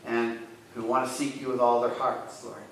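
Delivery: soft, earnest prayer voice. Real, not acted. Pastor praying softly for the lonely. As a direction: pray quietly to God for the lonely.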